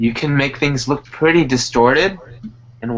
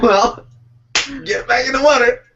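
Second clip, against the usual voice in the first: higher, louder mock coach shout; 10 semitones higher, 4 dB louder than usual. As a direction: bark like a playful swim coach. Playing a coach yelling at swimmer.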